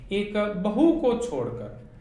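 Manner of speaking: normal talking voice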